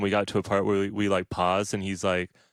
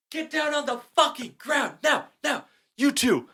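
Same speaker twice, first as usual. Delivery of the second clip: much higher, strained cop shout; imitating a yelling cop; 4 dB quieter than usual. Cop ordering him to the ground at four AM: high mock shout. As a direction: bark the order like a cop mid-arrest. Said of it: "who just yelled that at you".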